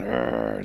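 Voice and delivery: low, loud "arr" growl; deep rumbling storybook wolf snarl. A narrator's reading. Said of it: "the wolves start growling".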